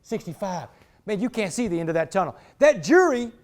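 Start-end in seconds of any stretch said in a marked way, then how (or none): 0.0-0.7 soft, much quieter fading echo; hushed repeated echo of a sentence; echoing in my head. Retold or imitated. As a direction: let it ring like an echo chamber. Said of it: "like being in an echo chamber".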